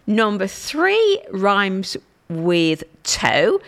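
slow listing. Shown as a demonstration. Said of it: "listing pronunciations slowly".

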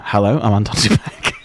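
ordinary talk

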